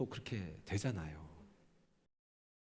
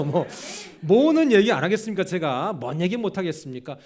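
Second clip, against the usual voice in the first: loud laughing protest; 6 semitones higher, 11 dB louder than usual. Real, not acted. Laughing joke about nickname.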